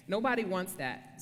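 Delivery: usual speaking voice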